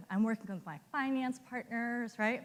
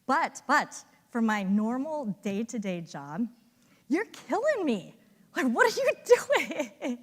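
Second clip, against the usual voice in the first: rising, louder exasperated plea; pleading frustration, pitched up high; exasperated colleague; 3 semitones higher, 6 dB louder than usual. Retold or imitated. Account director exasperated with Monica, rising complaint. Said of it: "And he says to me".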